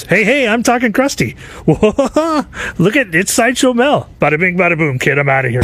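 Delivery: high, raspy showbiz clown voice; brash clown barging in with catchphrases; Krusty the Clown. Retold or imitated. Clown barges into the recording booth, high brash Krusty voice.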